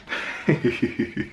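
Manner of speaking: short unvoiced giggle; a quick run of breathy giggles. Real, not acted. Reviewer tickled on reaching casting and actors, giggling. Amused before the casting category.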